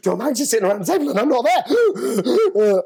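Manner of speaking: a mock-frantic thought; retold panic. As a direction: play the remembered panic for laughs. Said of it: "I think I had a panic attack".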